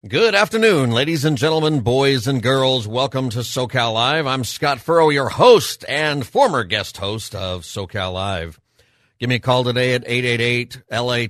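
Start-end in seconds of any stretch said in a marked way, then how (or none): 0.1-8.6 big booming ringmaster welcome. Acted. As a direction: announce it like a circus ringmaster. Radio show opening welcome.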